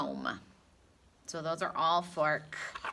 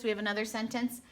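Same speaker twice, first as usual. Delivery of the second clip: ordinary talk, nothing marked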